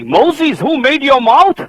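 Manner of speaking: high, loud rebuke; God angrily scolding Moses; angry God. Acted. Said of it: "He was angry".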